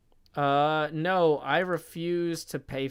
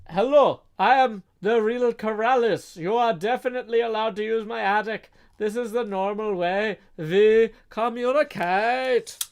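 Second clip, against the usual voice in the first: higher, louder put-on voice, slowing at the end; impostor voice; 5 semitones higher, 6 dB louder than usual. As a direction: read it as a fake Corrales claiming the attic. Reading donor's impostor message aloud.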